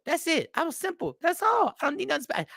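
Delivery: high-pitched, insistent and simple. Acted. Customer at the takeout counter, insisting in a high voice. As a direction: squeak it out like a fussy customer.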